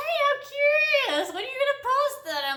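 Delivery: squeaky curious ask; high-pitched friend voice. Retold or imitated. Friend pestering her about a post, high and eager.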